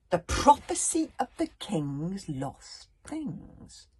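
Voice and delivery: slow, portentous reading; mysterious. Acted. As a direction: intone it like an ominous prophecy. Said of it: "rather mysteriously".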